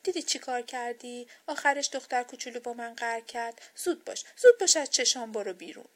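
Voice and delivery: loud, angry scolding; very upset. A narrator's reading. Doll blaming Sleep and ordering it out, very upset. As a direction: scold loudly, building to a sharp order. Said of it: "خیلی ناراحت شد و به خواب گفت".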